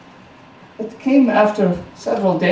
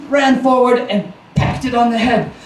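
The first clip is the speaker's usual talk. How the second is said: plain reading voice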